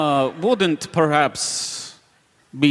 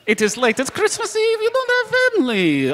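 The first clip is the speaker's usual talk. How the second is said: high mocking voice. Bartender teases.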